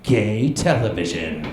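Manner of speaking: mock announcer with a long-held ending; imagined slogan announcer. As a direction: parody a tagline, dragging out the apology. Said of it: "which must have been like".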